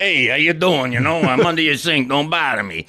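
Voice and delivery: put-on gruff Brooklyn plumber accent. Brooklyn accent at first audition. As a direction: tough Brooklyn guy at the audition. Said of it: "I know what that sounds like".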